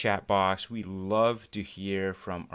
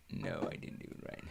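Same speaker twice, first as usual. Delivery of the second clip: whispered under the breath, much softer; embarrassed aside whispered to nobody; muttered to self; 13 dB quieter than usual. Real, not acted. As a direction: mutter the correction to yourself. Muttering own mistake to self.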